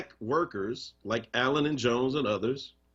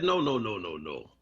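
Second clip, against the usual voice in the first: put-on French accent; 6 semitones lower than usual. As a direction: toss off a playful French doctor's "no". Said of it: "I'm gonna leave that French accent alone".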